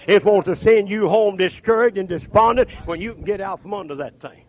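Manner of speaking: urgent sermon cadence; forceful preaching. Real, not acted. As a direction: drive each phrase home from the pulpit.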